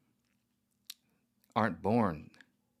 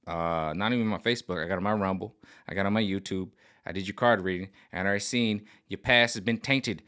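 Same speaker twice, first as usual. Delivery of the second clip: ordinary delivery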